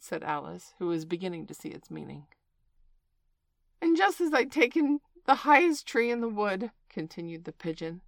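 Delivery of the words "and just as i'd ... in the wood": high, loud aggrieved outcry; rising to shriek. A narrator's reading. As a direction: build your complaint toward a shriek. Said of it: "raising its voice to a shriek".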